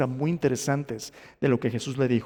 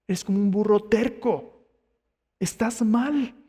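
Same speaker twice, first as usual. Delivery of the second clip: higher-pitched scolding rebuke; Jesús; 5 semitones higher than usual. Acted. Jesus rebukes Saulo for going his own way, higher-pitched scolding. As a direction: set a stubborn pupil straight, pitch raised. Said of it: "mira lo que Jesús le dice".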